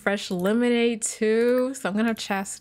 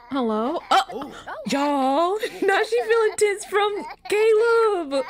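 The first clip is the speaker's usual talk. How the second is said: high-pitched words broken up by laughter; incredulous laughing; 8 semitones higher, slower than usual. Real, not acted. Gamer telling viewers she's feeling tense from Caleb, cracking up. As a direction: squeal it out while cracking up. Cracking up at game character's reaction.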